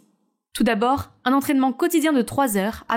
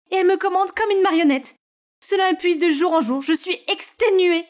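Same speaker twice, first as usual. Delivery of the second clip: higher, weary character voice, slowing; exhausted complaining girl; 7 semitones higher than usual. Acted. Scarlett complains to Naomi about her mother, higher tired voice. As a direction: pour out frustration to your sister.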